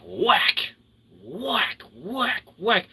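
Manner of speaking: high, loud clipped whack noises; mock whack sounds. Describing police beating sticks, playful whack sounds. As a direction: smack out each whack like a stick hit.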